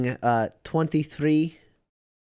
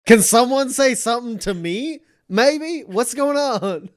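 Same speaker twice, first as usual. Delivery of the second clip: high, loud, bewildered plea; exasperated demand; 11 semitones higher, 6 dB louder than usual. Acted. Leonard begs the others to explain, exasperated. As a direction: demand to be let in, pitch soaring.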